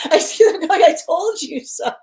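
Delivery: high, loud laughing quote; amused, stretched-out imitation. Real, not acted. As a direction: laugh through the mock gloating. Laughing while voicing the smug quote.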